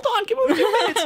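fast, high, laughing plea; desperate plea. Retold or imitated. Host acting the characters begging for minutes, laughing. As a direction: rush out the plea.

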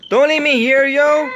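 very high, loud begging; panicked plea. Real, not acted. Player begging a teammate to stay, panicked plea. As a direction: cry out as if being abandoned.

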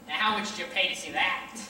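ordinary delivery

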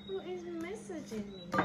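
normal talking voice

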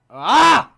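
sharp high loud cry; loud anguished shout. Real, not acted. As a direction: yelp at the near miss. Reacts to a near miss.

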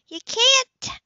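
Anna stopping Cam's rage, alarmed shout; alarmed cry. A narrator's reading. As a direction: cry out to stop him. Anna stops Cam in rage.

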